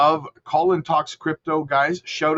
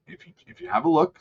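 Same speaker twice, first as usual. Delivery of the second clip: usual speaking voice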